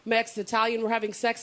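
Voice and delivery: ordinary talk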